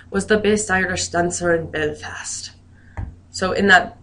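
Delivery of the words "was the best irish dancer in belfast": best attempt at an Irish accent; hammed-up Irish accent for an example sentence. Shown as a demonstration. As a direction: put on a playful Irish lilt. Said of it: "use my best Irish accent here".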